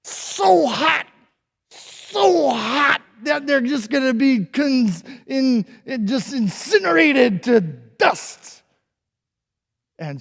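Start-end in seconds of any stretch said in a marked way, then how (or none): 0.0-8.6 high, loud slow raging roar; furious king. Acted. King ordering guards, furious slow roar. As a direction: tear into them. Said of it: "he tore into them and he said".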